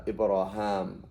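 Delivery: "Ibrahim" slowly said as ib-ra-ham; -ham version. Shown as a demonstration. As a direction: end "Ibrahim" on -ham instead of -heem. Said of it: "they have different versions".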